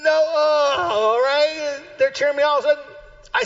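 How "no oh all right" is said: higher strained groaning cry. Acted. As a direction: groan as you wobble and recover. Acting out nearly falling.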